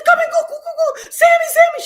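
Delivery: high, urgent reenacted summons; acted frantic excitement, voice shooting up; frantic calling. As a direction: call out in excited, repeated bursts. Acting out frantic call to daughter.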